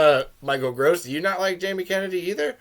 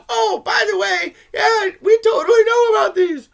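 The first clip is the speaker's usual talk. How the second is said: high, loud mocking falsetto; sing-song a flippant reveal in a much higher voice; a squeaky mock voice for the lady's offhand admission; mock casual; 13 semitones higher, 7 dB louder than usual.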